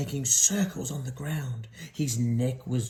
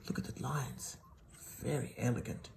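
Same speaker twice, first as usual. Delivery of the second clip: hushed aside; 2 semitones lower, 11 dB quieter than usual. Real, not acted.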